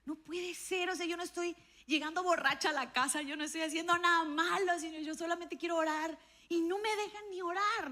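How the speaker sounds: put-on teen angry at not being allowed to pray; frustrated outburst. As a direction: protest you're doing nothing wrong. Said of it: "me enojé tanto y dije".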